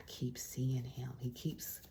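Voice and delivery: slow, whispered confession; confiding whisper. Real, not acted. To the viewer, about the man in the readings: a slow whisper. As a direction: let it slip out in a hush. Reader confides a recurring card.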